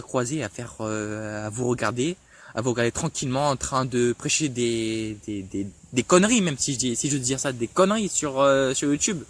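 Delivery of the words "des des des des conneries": low, stammering search for the word; real stammer on a repeated small word; bégaiement. Real, not acted. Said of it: "désolé si je bégayais encore un peu". (bégaiement, voix basse)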